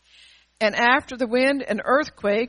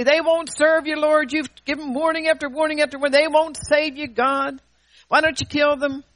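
higher, louder indignant complaining; hammed-up outrage, raised and louder; mock indignant plea; 5 semitones higher, 3 dB louder than usual. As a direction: play the self-righteous accuser to God. Acting a vengeful plea to God.

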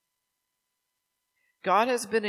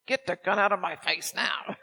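exasperated outburst. Retold or imitated. Telling the kids to stop with the toy gun, retold exasperated outburst. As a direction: let patience snap for a moment, half-joking.

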